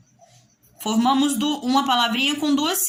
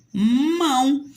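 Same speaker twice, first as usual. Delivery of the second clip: "mão" as one held, higher syllable; one unbroken syllable; 4 semitones higher, 4 dB louder than usual. Shown as a demonstration. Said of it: "Mão eu não vou separar".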